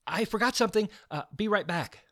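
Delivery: quick, high blurt. Acted. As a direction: rush it out fast. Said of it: "Georgia blurted out".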